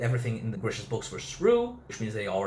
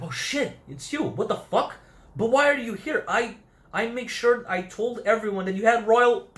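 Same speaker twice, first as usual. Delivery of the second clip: high, louder burst of mock alarm; retold outburst, pitched way up; shocked recognition; 9 semitones higher, 4 dB louder than usual. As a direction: blurt it out in startled disbelief. Acted shock at recognizing someone.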